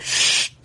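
imitated fizz of an opening bottle; a voiceless "shh" for the escaping fizz; soda-opening hiss. Teacher mimics a Coke being opened in the study hall, imitated hiss. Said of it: "he opens up a Coke".

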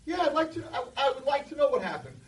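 sarcastic teacher. Retold or imitated. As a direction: play the teacher humouring the kid. Teacher answers in story.

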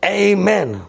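high, loud shouted Amen; booming imitation of seventy people; screamed crowd response. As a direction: belt it out like a roused congregation. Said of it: "the seventy people go and scream".

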